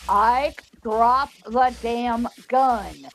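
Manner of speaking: slow, deliberate lawman's order; emphatic slow command. Retold or imitated. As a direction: land each word of the retold order. Retelling Wyatt's firmer order.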